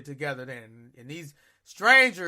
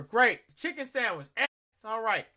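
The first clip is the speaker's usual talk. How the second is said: usual speaking voice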